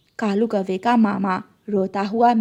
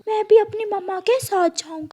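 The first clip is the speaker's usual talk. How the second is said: a small son warning his mother gently; little chick voice; 10 semitones higher, faster than usual. Acted.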